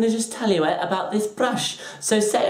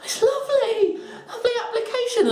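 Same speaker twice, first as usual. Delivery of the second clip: very high squeaky praise; hammed-up nervous compliment; scared politeness. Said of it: "I was scared of this brush".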